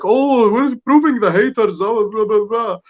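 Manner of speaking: high, slow mocking sing-song; mocking sister's tweet. Pundit mocking the captain's sister's tweets in a high, slow sneer. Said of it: "to tweet like".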